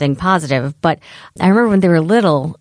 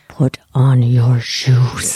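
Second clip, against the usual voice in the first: low, very slow growl; lowered mommy growl; 5 semitones lower, much slower than usual. Retold or imitated. Mom saying it with a dead stare, growled. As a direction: drop the voice and draw out each word menacingly. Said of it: "it's a lowering of the voice".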